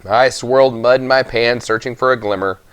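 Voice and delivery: usual speaking voice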